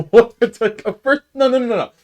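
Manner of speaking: high, loud, stumbling indignation; flustered sputtering. Real, not acted. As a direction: sputter in disbelief, voice jumping up high. Flustered, indignant rant about tourists.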